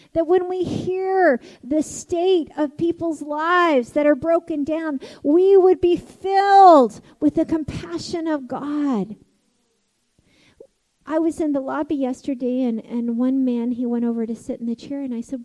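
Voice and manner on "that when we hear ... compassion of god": slow, loud, rising preacher's cry; a pastor urging the church to compassion, loud and slow; full-force preaching. Real, not acted. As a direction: preach it out with fervour.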